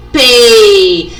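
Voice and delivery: exaggerated, drawn-out "P"; demonstrated letter. Shown as a demonstration. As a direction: stretch "P" out loudly for a child to watch.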